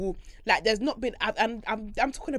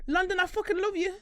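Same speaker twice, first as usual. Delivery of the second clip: higher, full-hearted cry of love for London; excited declaration; 10 semitones higher than usual. Real, not acted. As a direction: shout it out to the city, giddy.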